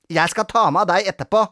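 fast, loud, high threat; rapid, bossy telling-off; cross threat. A narrator's reading. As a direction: threaten a naughty pupil. Grete threatens Robin.

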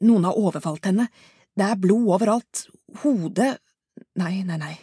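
frantic woman calling emergency services; panicked caller. Acted. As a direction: beg for help in terror.

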